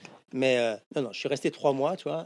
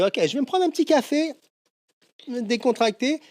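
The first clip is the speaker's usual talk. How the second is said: much higher-pitched, easygoing inner voice; carefree self-quote; 10 semitones higher than usual. Retold or imitated. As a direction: act out your own naive little plan in a high lilt. Acts out his naive first-day plan.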